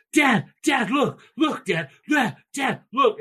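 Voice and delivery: put-on high, loud excited little girl; excited child calling. Little daughter calling dad to look, high and excited. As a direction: shout it like a thrilled kid at the beach.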